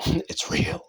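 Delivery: breathless laugh through the words; laughing disbelief. Real, not acted. Amazed the ring worked, laughing.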